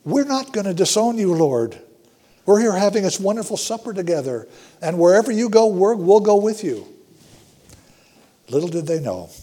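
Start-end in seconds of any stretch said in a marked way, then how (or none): 0.0-6.9 higher, louder, eager pledge; eager disciples' promise. Acted. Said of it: "They were all saying".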